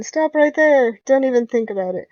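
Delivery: loud sudden order to halt; shouted challenge. Acted. McCree catches the sneaking player, loud shout. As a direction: bark it out to stop an intruder.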